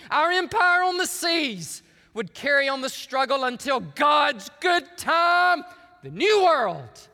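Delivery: loud, slow rallying oratory, rising to a shout; emotional Churchill speech. Acted. Preacher reading Churchill's vow to never surrender, emotional oratory. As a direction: drive each phrase home like a wartime leader. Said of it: "I'm getting emotional reading Churchill".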